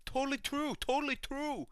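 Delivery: high-pitched mock voice; imitated character. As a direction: squeal it as an imitation. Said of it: "He's like".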